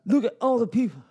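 rousing locker room coach; coach pep talk. Acted. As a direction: fire up the team like a coach. Plays coach giving pep talk.

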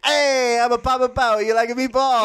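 put-on high hammy Italian accent. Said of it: "went to Italian".

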